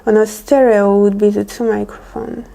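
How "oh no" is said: normal talking voice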